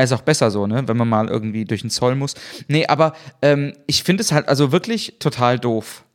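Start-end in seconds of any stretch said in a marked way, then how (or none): none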